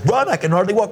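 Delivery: high-pitched disbelief as the grandmother; acted bewildered objection; imitated incredulous protest. Grandmother baffled at being told run.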